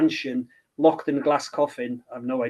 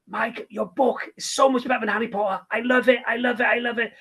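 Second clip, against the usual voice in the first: put-on higher, excited child-like praise; gushing grandson; 7 semitones higher than usual. Grandson raving to the author about the book, excited. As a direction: gush like a thrilled young reader. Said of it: "He was like".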